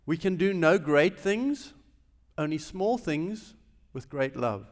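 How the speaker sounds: measured, emphatic recitation; quoting Mother Teresa. Retold or imitated. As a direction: lean on each word of the quote. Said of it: "As Mother Teresa says".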